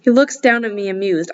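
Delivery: usual speaking voice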